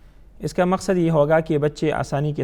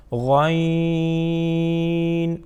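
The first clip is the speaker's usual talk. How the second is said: drawn out spelling; much slower than usual. Shown as a demonstration. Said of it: "teacher drawing out the spelling".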